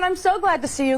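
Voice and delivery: high, sweetly gushing; overly friendly greeting. Retold or imitated. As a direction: greet the cop like an old friend. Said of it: "So I said".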